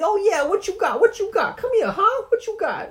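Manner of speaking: very high, loud boxing taunt; cocky taunting squeak. Acted. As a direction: bounce and taunt like a boxer. Stoogie taunting boxer.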